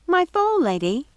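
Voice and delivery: high, puzzled housekeeper voice; wavering, lost old woman; confused old woman. Acted. Mrs Tittlebottom asks Gothel about her fall, confused.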